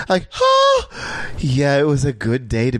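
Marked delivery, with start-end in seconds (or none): 0.3-1.3 very high gasp of surprise; starstruck gasp. Retold or imitated. Seeing a celebrity walk past, high gasp. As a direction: gasp as a star appears. Seeing another star arrive.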